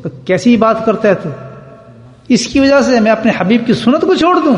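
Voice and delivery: high-pitched, outraged retort, acted out; imitating a man crying out in protest; retold indignant cry. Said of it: "انہوں نے زور سے چیخا".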